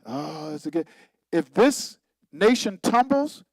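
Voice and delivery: ordinary talk, nothing marked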